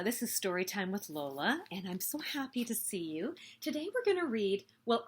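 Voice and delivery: ordinary delivery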